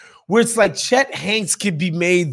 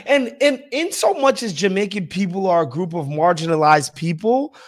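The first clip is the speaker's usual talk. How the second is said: normal talking voice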